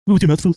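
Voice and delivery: "With your mouth full" said high and loud, each word set apart as if in quotes; italics and quotes voice. Shown as a demonstration. Mocking a podcast rule to her cohost, "mouth full" stressed high and loud. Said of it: "That's italics and quotes voice".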